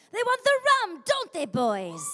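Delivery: hammed-up lady pirate rallying the boys; Pirate Red. Pirate Red calling to her crew, loud high pirate bravado. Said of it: "She's like".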